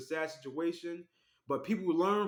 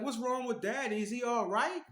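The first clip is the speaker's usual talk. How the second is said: put-on higher, small child voice; imitating worried kids; 7 semitones higher than usual. Children asking about their daddy, higher childlike voice. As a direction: ask as a frightened little kid.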